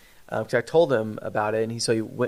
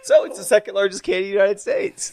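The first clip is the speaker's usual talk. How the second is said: higher, louder punchline through laughter; laughing; 8 semitones higher, 4 dB louder than usual. Real, not acted. Host repeating the clerk's boast, laughing. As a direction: repeat it while still laughing.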